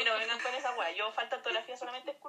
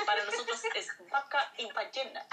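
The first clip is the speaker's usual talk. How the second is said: said through laughter, higher; laughing; 8 semitones higher than usual. Real, not acted. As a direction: laugh while saying it. Repeats joke while laughing. (entre risas)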